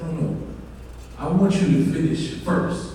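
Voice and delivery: hint of a father, low and soft. Father corrects his son, softly insistent. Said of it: "his father told him".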